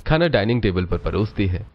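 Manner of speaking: ordinary talk, nothing marked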